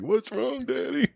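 imitating the kids asking dad what's wrong; scared kids. Kids voiced in scary story.

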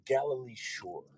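whispered punchline; whispering. Acted. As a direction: whisper it as a joke. Punchline aside.

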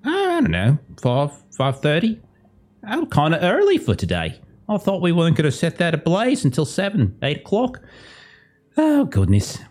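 high, folksy put-on character voices; chatting villagers. Game master voices villagers chatting about a fire, high casual mock voices. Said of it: "You just hear them like".

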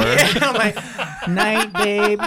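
giggling out the goodnight; laughing. Real, not acted. Laughing while quoting himself.

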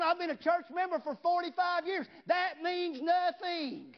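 loud, high, shouting revival preacher; full force preaching. Acted. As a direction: preach it out loud to the whole church.

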